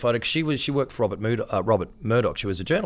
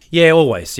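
emphatic; 8 semitones higher, 10 dB louder than usual. Real, not acted. Emphatic first answer.

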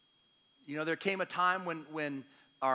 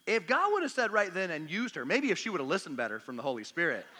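ordinary talk, nothing marked

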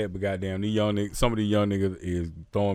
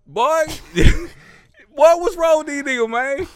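high, loud disbelief at other rappers; exasperated high-pitched cry; incredulous outburst; 7 dB louder than usual. Real, not acted. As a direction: throw your hands up, high and loud.

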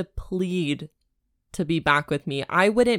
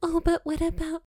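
mocking heroine; 10 semitones higher, 3 dB quieter than usual. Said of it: "mocking the heroine".

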